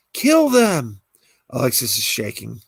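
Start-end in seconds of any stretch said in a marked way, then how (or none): 0.1-1.0 high, loud push to kill; urging command. Acted. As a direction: egg her on, high and loud. Hallucination urging Alexis.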